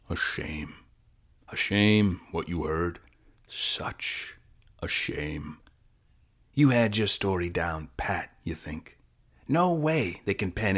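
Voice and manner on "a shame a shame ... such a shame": low, hushed musing, with pauses. Acted. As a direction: let "such" fade almost to a whisper. Cop voices regret to witness.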